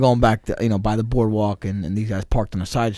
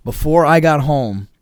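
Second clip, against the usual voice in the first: ordinary delivery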